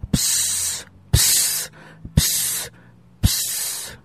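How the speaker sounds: soft breathy psst hisses from the snake. Acted. The serpent hissing softly at the young wife. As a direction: hiss each psst like a serpent. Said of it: "siffla-t-il".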